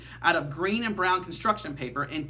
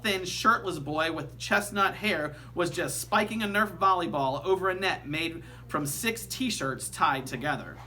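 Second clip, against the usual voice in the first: plain reading voice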